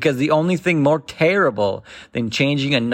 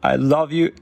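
tearful goodbye. Retold or imitated. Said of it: "I would look at my mom and say".

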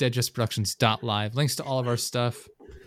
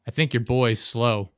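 slow, louder deadpan insult; 5 dB louder, slower than usual. Retold or imitated. Quoting Billy Bob Thornton's character to the father, slow menacing deadpan. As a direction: drawl it out flatly like a menacing stranger.

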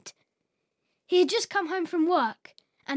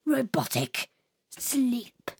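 plain reading voice

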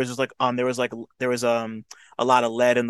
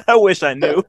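laughing; 8 semitones higher, 5 dB louder than usual. Real, not acted. Laughs at absurd news.

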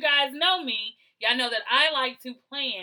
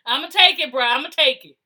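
shouting that she'll take the blame; loud acceptance; 3 semitones higher, 10 dB louder than usual. Real, not acted. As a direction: belt out I'll take it.

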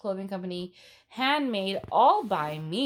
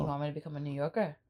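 put-on New York accent; 4 semitones lower, 4 dB quieter, much faster than usual. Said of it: "apologize for your New York accent".

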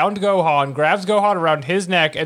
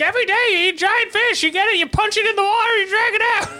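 high, fast mock guru voice; hammed-up influencer preaching; Giant Fish King; faster than usual. Cohost imitating a fish-eating fitness king, high and fast. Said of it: "Giant Fish King, and he'd be like".

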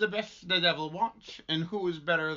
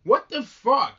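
shocked; 8 semitones higher, 10 dB louder, slower than usual. Real, not acted. Outraged reaction.

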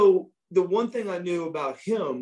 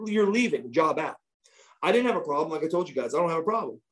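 ordinary talk, nothing marked

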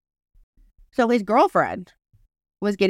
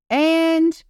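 high, louder, stretched-out announcer's stall; hesitant MC; 7 semitones higher, 4 dB louder than usual. Retold or imitated. MC reading wedding intros off a paper, stalling. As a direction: imitate an emcee drawing out the word, pitched up.

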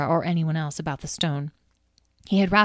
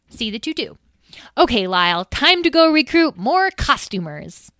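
clipped brisk orders; brisk bossy; 7 semitones higher, 5 dB louder than usual. Acted. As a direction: Edwene briskly ordering Tom, then Lyle, a brisk command. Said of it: "Edwene nodded briskly".

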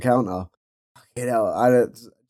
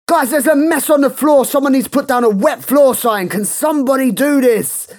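high, loud, frantic call-out; hyper shop worker; 11 semitones higher, 4 dB louder than usual. Retold or imitated. The worker yelling to staff about the mess, high and loud. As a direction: announce the spill like it's a crisis. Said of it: "going crazy".

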